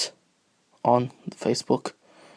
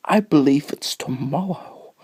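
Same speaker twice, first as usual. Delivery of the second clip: ordinary delivery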